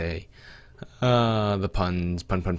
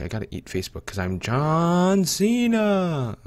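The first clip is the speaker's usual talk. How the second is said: excited; 9 semitones higher than usual. Real, not acted. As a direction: pump it up like a wrestler. Hyped gamer boast.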